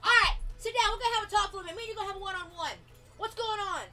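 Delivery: high, loud, bossy parent; stern interrogation. Acted. Mother sitting daughter down for one-on-one, stern. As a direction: bark orders, then demand an answer. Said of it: "I'll tell you how it is".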